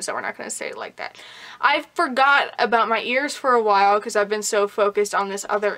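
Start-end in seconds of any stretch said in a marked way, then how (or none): 0.0-1.2 fast whispered aside to herself; rushed whisper under her breath. Real, not acted. Quick self-correcting aside.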